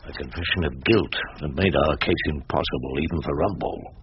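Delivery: low narrator aside; story narration. A narrator's reading. Rumpole commenting on the hopeless case, wry narration.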